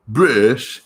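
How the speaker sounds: British said in a mock posh British accent; a quick, badly faked British accent on British; failed British accent. Shown as a demonstration. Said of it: "A failed attempt at a British accent".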